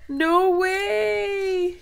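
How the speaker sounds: high, loud delighted cry; overjoyed disbelief. Real, not acted. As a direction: shriek it in happy shock. Overwhelmed opening the gift.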